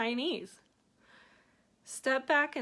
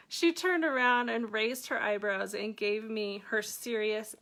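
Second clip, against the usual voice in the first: ordinary talk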